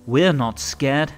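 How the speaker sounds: usual speaking voice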